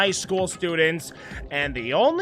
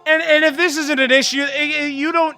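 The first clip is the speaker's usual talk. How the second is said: loud, higher furious rant; angry yelling; 4 semitones higher, 8 dB louder than usual. Acted. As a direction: shout down the counter theory. Kyoko yelling back at Makoto.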